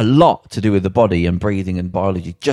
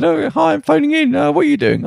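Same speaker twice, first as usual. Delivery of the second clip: very high mock caller voice, pitched way up; squeaky caller impression. Mimicking a caller phoning in to a radio show, squeaky and high. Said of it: "I enjoyed your impression of young people going out".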